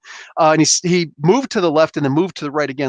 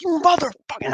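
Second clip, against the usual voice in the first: mad; 11 semitones higher than usual. Retold or imitated. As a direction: reenact an angry outburst at an attacker. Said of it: "I'm mad now".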